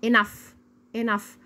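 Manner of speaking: "enough" with an f at the end; spaced model repeats of "enough"; final GH as F. Shown as a demonstration. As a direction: say "enough" as "inaf". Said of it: "زي صوت حرف الاف بالظبط".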